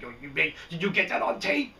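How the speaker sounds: mock producer. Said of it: "That's what I could imagine".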